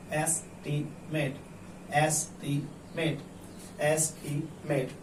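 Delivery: "Estimate" split into es, ti, met with gaps; careful broken-up sounding-out of "estimate"; slowly, syllable by syllable. Shown as a demonstration. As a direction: read "estimate" slowly, one syllable at a time. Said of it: "उसे धीरे-धीरे पढ़िए। ऐसे".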